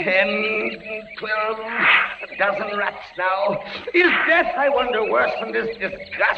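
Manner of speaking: high, strained voice of disgust; horrified. Acted. Bound prisoner under crawling rats, high and horrified. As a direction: choke out the horror.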